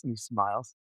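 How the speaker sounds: normal talking voice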